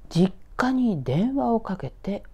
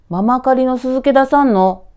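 father's voice; 7 semitones higher, 10 dB louder than usual. Acted. Father asks mother at dinner.

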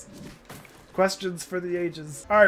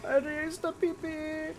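silly voice; 5 semitones higher, 6 dB quieter than usual. Pretend. Silly put-on voice, mocking.

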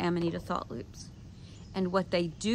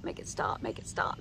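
whispered, breathless plea; desperate whisper; 7 dB quieter than usual. Retold or imitated. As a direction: beg under your breath for it to end. Pleading during bad trip.

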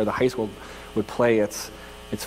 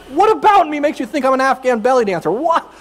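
much higher, louder disbelief; incredulous; 11 semitones higher, 7 dB louder than usual. Real, not acted. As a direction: rise in stunned outrage. Stunned disbelief at the request.

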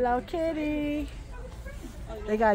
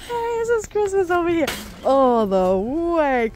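higher, louder, slowing on the end; excited announcement; 5 semitones higher, 5 dB louder than usual. Real, not acted. Shopper showing viewers the Christmas aisle, loud excited call. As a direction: proclaim it proudly to the room.

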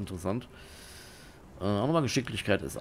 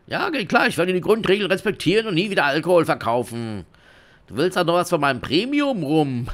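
higher, loud flustered surrender; beaten dealer caving; 10 semitones higher, 10 dB louder than usual. Acted. Beaten dealer promising to respect the rules, loudly flustered. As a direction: give in fast in a hammed-up high voice.

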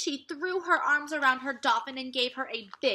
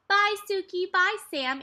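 louder, higher call across water; calling goodbye; 9 semitones higher, 5 dB louder than usual. A narrator's reading. Girl calling after swimming dolphins, higher louder shout. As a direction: wave them off with a shout. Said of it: "Annie shouted".

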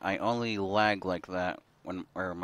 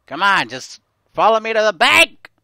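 loud, higher exasperated shout; frustrated yell; 10 semitones higher, 14 dB louder than usual. Real, not acted. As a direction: yell at the stubborn game. Frustrated at game.

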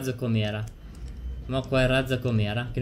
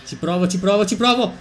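rising tense excitement, louder and higher; tesa eccitazione; 5 semitones higher, 5 dB louder than usual. Real, not acted. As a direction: push it, straining to make it. Tensione in gara, tentativo. (eccitazione crescente, più acuta e forte)

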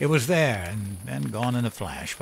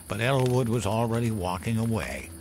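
plain reading voice

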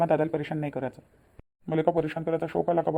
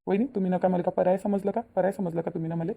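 ordinary talk, nothing marked

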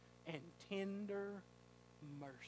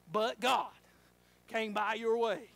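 But God said much louder; 2 semitones higher, 9 dB louder, slower than usual. Real, not acted. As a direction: lift But God into a call.

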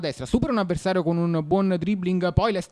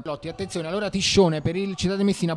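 usual speaking voice

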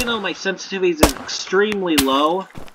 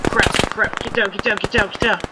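urgent callout; 5 semitones higher than usual. Real, not acted. Under fire, urgent callout.

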